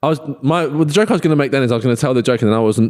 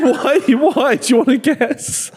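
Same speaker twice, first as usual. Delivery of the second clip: high-pitched, incredulous laughing question; 9 semitones higher than usual. Real, not acted. Friend baffled by the guessing habit, laughing. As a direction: ask it while cracking up.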